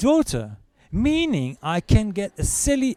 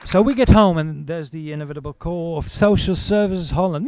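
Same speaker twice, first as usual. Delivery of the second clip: ordinary talk, nothing marked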